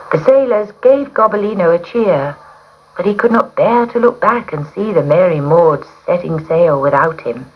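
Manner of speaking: plain reading voice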